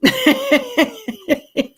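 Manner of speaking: loud high laugh, real and helpless; high laughing burst. Real, not acted. Laughing at her own chaotic livestream, helpless laugh. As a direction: let a big laugh burst out.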